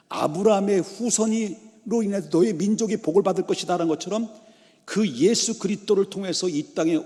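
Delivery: high, loud, driving sermon delivery; impassioned preaching. Real, not acted. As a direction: press on forcefully without letting up. Preaches at full force. (열정적으로 힘주어 설교)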